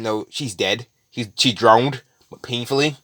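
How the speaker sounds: usual speaking voice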